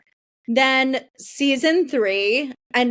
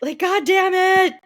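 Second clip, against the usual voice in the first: exasperated; 7 semitones higher than usual. Real, not acted. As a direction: blow up in disbelief. Exasperated rant about the show.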